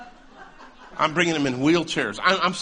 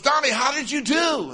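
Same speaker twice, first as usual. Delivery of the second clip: imitating the pastor with a much higher voice; pastor's eager greeting; 11 semitones higher, 3 dB louder than usual. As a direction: call out the question brightly, pitched way up. Acting out pastor walking in, greeting him.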